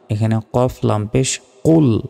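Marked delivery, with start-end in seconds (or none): 1.6-2.0 full, rounded Arabic "qol"; heavy qol. Shown as a demonstration. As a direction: hit "কুল" with a throaty q and rounded vowel. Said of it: "কুল মোটাভাবে উচ্চারণ হবে".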